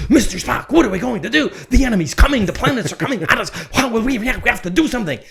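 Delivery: panicked Captain Kirk. Retold or imitated. Kirk yelling at Spock as the enemy closes in, frantic and loud. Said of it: "the absolutely quintessential Captain Kirk".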